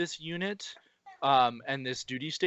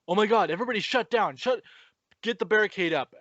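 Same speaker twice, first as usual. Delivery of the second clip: higher, louder and fast, imitating panicked orders; mock panic; 6 semitones higher, 4 dB louder than usual. Retelling the movie's soldiers as Godzilla arrives, fast mock panic. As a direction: rattle it off like the soldiers are freaking out. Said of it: "Godzilla's showing up and".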